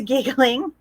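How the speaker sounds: plain reading voice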